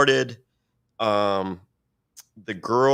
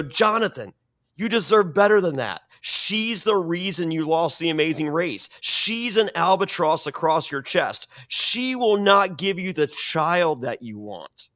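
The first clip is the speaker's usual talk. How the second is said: raised, high-pitched retold taunt; goading call-out; 6 semitones higher than usual. Contestant goading his rival about the wife, taunting call-out. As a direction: call out to goad him, drawing out the last lines. Calls out rival to goad him.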